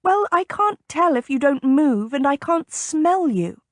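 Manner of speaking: mild irritated snap; snapping. A narrator's reading. Lady snapping at the captain after her fright. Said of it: "even as she snapped".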